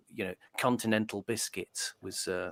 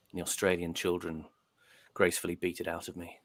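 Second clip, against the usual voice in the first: usual speaking voice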